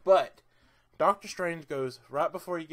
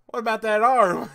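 higher, much louder cheeky question; retold Rocket line, pushed loud and high; loud Rocket quote; 7 semitones higher, 14 dB louder than usual. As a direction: belt out the raccoon's greedy ask. Retelling Rocket's movie line.

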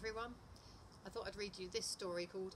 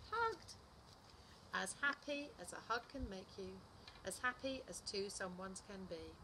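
animated picture-book narration, pitch swinging up; lively storytime voice, key words punched; story reading to children; 4 semitones higher, 3 dB louder, slower than usual. A narrator's reading. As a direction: read it to little children with big expressive emphasis. Reads second story aloud.

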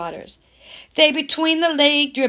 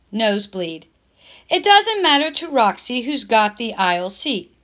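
ordinary delivery, nothing marked

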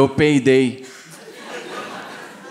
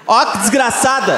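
higher exasperated curse; mock outrage; 6 semitones higher than usual. Real, not acted. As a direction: burst out in comic outrage. Outraged exclamation about bill. (indignação cômica)

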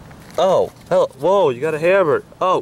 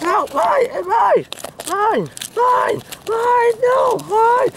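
put-on childish squabble; much slower than usual. Two puppets fight over a toy.

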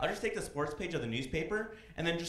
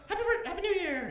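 mock angry clerk; 4 dB louder than usual. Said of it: "the guy's gonna be like".

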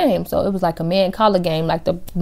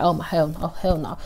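muttering; 7 dB quieter than usual. Retold or imitated. Teller muttering in dismay.

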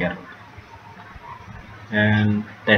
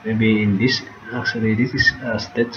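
normal talking voice

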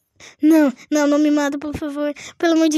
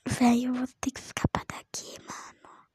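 urgent breathy whisper; rushed unvoiced whisper to run; hushed whisper; 6 semitones lower, 11 dB quieter than usual. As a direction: whisper so the killer won't hear. Whispering while hiding.

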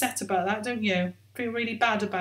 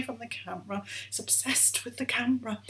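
soft unvoiced whisper; whispered aside; 9 dB quieter, faster than usual. Real, not acted. Presenter whispering an aside to viewers about the puppet. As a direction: tell the audience a little secret under your breath.

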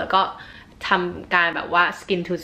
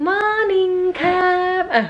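put-on very high, very slow baby voice; mother pretending to be her son saying morning; voicing the baby; 12 semitones higher, 3 dB louder, much slower than usual. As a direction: speak for the baby in a tiny voice. Mother voices baby greeting viewers.

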